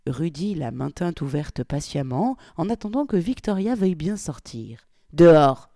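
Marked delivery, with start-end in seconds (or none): 5.1-5.7 very loud single command; barked order. A narrator's reading. Polly barking at Victoria to get out. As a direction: throw the word at her. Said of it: "jeta Polly".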